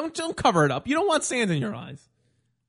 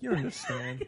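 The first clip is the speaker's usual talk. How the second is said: ordinary talk, nothing marked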